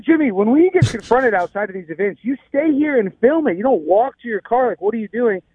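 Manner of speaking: high-pitched retold telling-off; exasperated scolding. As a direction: scold an assistant who let you down, pitched high. Retold scolding of his assistant.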